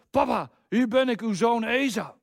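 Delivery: a son faking his brother's voice to his blind father; light impersonation of Esau; imitating Esau's voice. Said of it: "probeert een beetje de stem van Esau na te doen".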